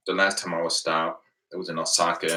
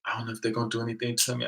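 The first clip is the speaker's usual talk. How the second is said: imitating a friend's rushed, higher, softer worry; retold panic; 4 semitones higher, 6 dB quieter, much faster than usual. Friend frets to him after the confrontation, mildly panicky. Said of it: "really panicking and stuff".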